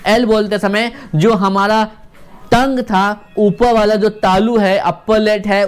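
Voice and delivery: ordinary talk, nothing marked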